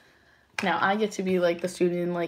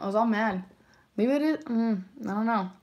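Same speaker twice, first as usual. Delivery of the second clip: ordinary delivery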